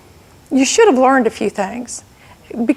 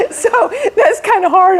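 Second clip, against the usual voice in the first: laughing; 7 semitones higher than usual. Real, not acted. Still laughing.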